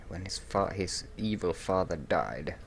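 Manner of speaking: ordinary delivery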